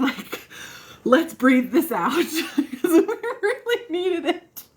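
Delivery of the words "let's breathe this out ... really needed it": high, drawn-out laughing delivery; real giggle stretching the words out. Real, not acted. Joking about needing grounding, laughing.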